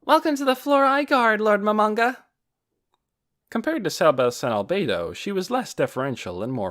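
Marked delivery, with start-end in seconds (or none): 0.0-2.2 put-on high, loud young girl voice; loud child voice. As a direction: greet him like an eager child. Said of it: "ever so slightly too loud voice that only children have".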